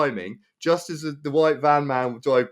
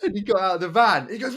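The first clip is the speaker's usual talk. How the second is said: ordinary delivery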